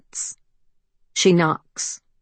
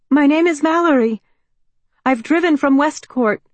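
put-on higher, louder, pleading young woman; anxious young woman; 9 semitones higher, 6 dB louder than usual. A narrator's reading. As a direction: call out, nervous and hopeful. Mallory answering.

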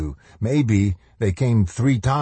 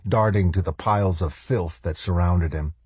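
plain reading voice

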